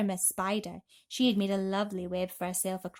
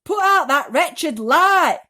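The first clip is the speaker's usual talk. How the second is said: loud, higher, slow shout; furious shout; 8 semitones higher, 14 dB louder, slower than usual. A narrator's reading. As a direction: hurl it at the ceiling. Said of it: "he shouted".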